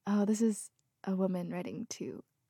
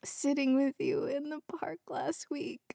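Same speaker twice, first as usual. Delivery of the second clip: about to cry; 9 semitones higher than usual. Real, not acted. Reader choking up over the widower's love letter. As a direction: voice breaking, high and slow. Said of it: "Yo, I'm about to cry".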